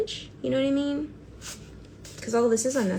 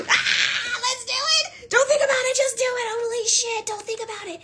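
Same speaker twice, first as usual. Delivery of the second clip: much higher, laughing pep talk; laughing hype; 12 semitones higher than usual. Real, not acted. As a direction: egg yourself on, giddy. Pumping herself up laughing.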